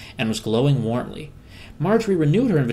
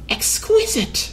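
slow, very high whispered squeak; a tiny critter savouring a treat; squirrel voice; much slower than usual. Acted. Squirrel Harold agrees, savouring.

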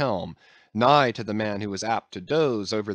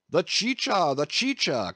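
cried out; 6 semitones higher than usual. A narrator's reading. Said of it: "cried Don Pedro".

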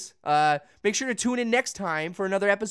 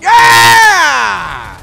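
loud, high victory yell; triumphant shout; 9 semitones higher, 16 dB louder than usual. Real, not acted. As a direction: celebrate the big hit.

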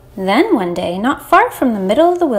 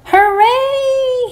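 very high, drawn-out gleeful yell; yelled cheer; 14 semitones higher, 4 dB louder than usual. Acted. Lamb's idea for jumping works, high excited yell. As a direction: cheer it high and long like a little lamb. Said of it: "he yelled".

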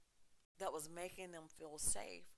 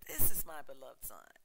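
booming, much louder proclamation; thunderous voice of God; 16 dB louder than usual. Acted. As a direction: let it thunder from the cloud. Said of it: "in a thunderous voice".

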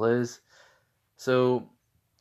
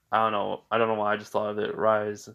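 ordinary talk

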